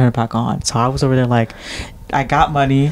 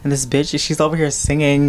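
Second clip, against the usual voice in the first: ordinary talk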